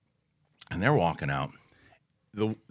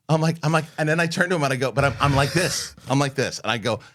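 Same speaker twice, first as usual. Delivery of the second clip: ordinary delivery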